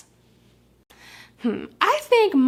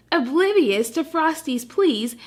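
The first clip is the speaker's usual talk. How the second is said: ordinary delivery, nothing marked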